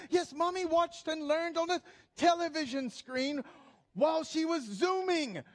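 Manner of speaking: hammed-up granddad voice, pitched high; emphatic granddad. Granddad explaining Zoom school to grandchild, hammed-up high voice. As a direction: answer with grand theatrical emphasis.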